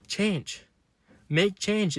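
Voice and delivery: rally them to make change; urgent call. Real, not acted. Vlogger urging depressed viewers to act, high urgent call.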